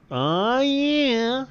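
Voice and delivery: high, loud, funny whoop; Slavo's catchphrase. Acted. Sidekick Slavo cheering about the detonator, high and loud. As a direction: belt out the silly catchphrase. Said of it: "That is Slavo's catchphrase".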